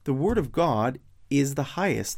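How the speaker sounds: usual speaking voice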